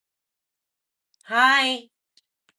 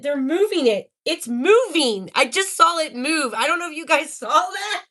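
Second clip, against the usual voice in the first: loud, thrilled live reaction; can't believe what you just saw; excited amazement; 3 semitones higher, 4 dB louder than usual. Real, not acted. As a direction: burst out in real astonishment, stretching the words. Startled that the ball moves.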